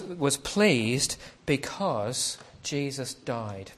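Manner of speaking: stretched out, each word weighed; slow emphasis. Real, not acted. Preacher slowing down on the key claim, drawn out. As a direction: let it land slowly. Key line of sermon, slowed.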